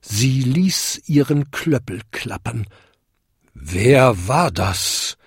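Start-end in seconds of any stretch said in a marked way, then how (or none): 3.5-5.2 slow, booming big-bell voice. A narrator's reading. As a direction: boom it out like a huge old bell. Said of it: "dröhnte die größte Glocke". (dröhnende große Glocke, langsam)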